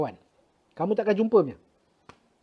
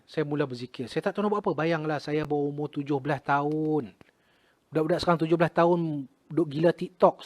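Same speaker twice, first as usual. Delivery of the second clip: ordinary delivery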